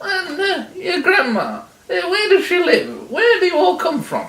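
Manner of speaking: put-on high, inquisitive old lady; curious granny making small talk; chatty granny. Granny asking the wolf about his family, high and chatty.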